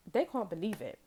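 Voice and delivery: ordinary talk, nothing marked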